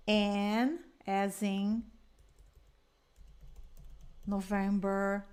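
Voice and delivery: very slow, drawn out spelling; slow spelling demo. Shown as a demonstration. Teacher showing how to spell on a call, slowly. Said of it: "você faz o quê?".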